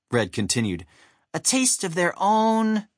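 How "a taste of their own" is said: high, slow character voice; high cartoon sidekick; Chuck. A narrator's reading. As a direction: join in high, drawing out the last word. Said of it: "Chuck joined in again".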